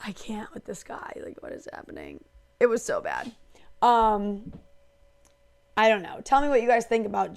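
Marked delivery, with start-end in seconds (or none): 0.0-2.2 hushed, disbelieving aside; exasperated whisper. Retold or imitated. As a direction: breathe it out in disbelief. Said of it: "I'm like".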